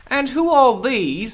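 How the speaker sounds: high, loud far queen voice; haughty Queen. Acted. The Queen demands who the gardeners are. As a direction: demand it from a throne.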